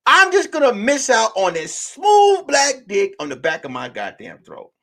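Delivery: high, incredulous reread of the line; mock-shocked reread; stunned repeat. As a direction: say it back like you can't believe it.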